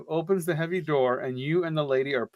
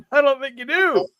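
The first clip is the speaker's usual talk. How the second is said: pitched-up threatening taunt; higher menacing taunt; 10 semitones higher, 4 dB louder than usual. Acted. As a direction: push the threat high and loud. First take of the threat.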